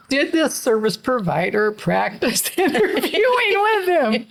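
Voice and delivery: high, catching voice broken by laughs; choked up, not crying. Real, not acted. Mother recalls her son's awful job interview, choked up. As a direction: hold back tears through laughter. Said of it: "I'm not, I'm not crying".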